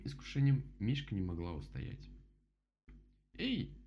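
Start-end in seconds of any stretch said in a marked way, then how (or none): 3.3-3.7 high-pitched cross protest; grumbling. A narrator's reading. Rabbit objects to drool, high grumbling. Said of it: "проворчал кролик".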